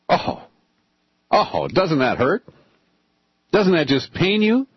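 milk the fake hurt, pausing between phrases; mock sympathy. Host reacts to O'Reilly abandoning radio, mock pained.

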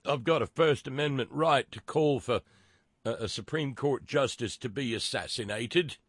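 weak South African accent impression; half-hearted South African accent. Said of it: "My South African accent sucks".

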